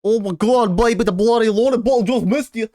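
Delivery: high-pitched, hammed-up Australian; mock Australian accent. Streamer mimics an Australian accent clip, hammed-up and high-pitched. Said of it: "Great example of an Australian accent".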